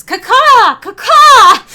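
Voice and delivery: shrieked high crow caw, twice; loud bird call. Acted. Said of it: "the secret signal of no more spoilers".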